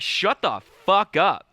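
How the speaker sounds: loud, high, slow telling-off; drawn-out mock fury, each word pushed; retold angry shout. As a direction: act out scolding a heckler from the stage. Retelling telling off mom onstage.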